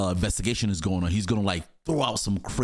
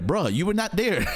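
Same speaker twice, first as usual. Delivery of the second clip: higher, incredulous and breaking into laughter; laughing; 9 semitones higher than usual. Real, not acted. Host mocking a father who was absent, laughing. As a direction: protest it through a laugh. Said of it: "I'm sorry I'm laughing".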